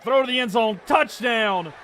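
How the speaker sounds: touchdown call. Real, not acted. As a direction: shout the touchdown like a commentator.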